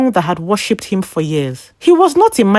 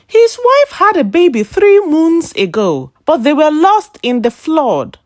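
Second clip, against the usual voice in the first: put-on old woman exclaiming; 8 semitones higher than usual. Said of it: "an old woman cried".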